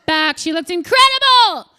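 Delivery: high, loud gushing; mock celebration. Comic praising mom's weight loss, loud sarcastic gush. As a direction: cheer it sarcastically.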